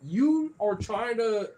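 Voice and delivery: high-pitched mock disgust at a pet; playful high-voiced telling-off; mock scolding.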